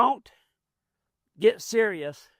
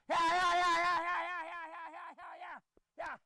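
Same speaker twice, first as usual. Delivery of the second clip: string of high yeahs, fading softer; shooing calls; 10 semitones higher, 9 dB quieter than usual. Real, not acted. Trainer bumps the mule's nose and shoos it with high calls. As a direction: keep pushing the mule back with high calls.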